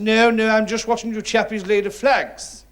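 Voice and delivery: high, loud toff's voice; Rolls Royce gentleman. Acted. Rolls Royce gentleman answers labourer.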